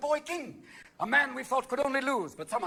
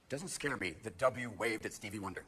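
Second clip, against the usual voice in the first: ordinary delivery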